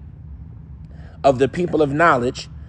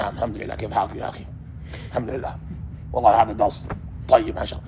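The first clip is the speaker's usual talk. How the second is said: lower, softer scholarly Arabic murmur; Ben Bana; 6 semitones lower, 6 dB quieter, faster than usual. Retold or imitated. Kids playing scholar, copying Ben Bana low and soft. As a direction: play the pious old scholar, low and hushed. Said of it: "we all talk like Ben Bana".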